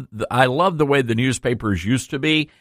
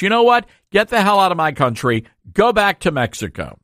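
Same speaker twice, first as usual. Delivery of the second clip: heated, rising outburst at protesters; fired up and indignant, pitched up; real anger boiling over on air; riled up; 6 semitones higher than usual. Real, not acted. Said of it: "I got a little riled up now".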